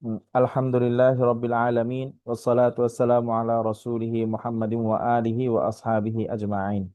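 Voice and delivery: measured, recited opening blessing; formal Islamic greeting. Real, not acted. Opening Arabic invocation. (আনুষ্ঠানিক সালাম ও দোয়া পাঠ)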